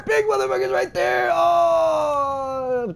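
panicked high shout; frightened shout. Real, not acted. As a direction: scream the warning. Spots the monster.

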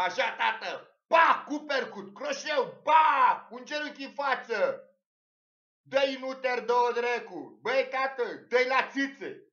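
high, loud coaching yell; imitating a father yelling fight advice; retold cheering yell. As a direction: egg on the fighter like a ringside fan.

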